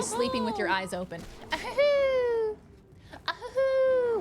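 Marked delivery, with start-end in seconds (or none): 1.5-4.2 very high loud squeaky giggle; Doughboy giggle. Pretend. Pillsbury Doughboy giggle imitation.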